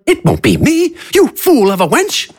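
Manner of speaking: high theatrical villain, smug; a smug, high showman announcing. Acted. AJ retorts, character voice.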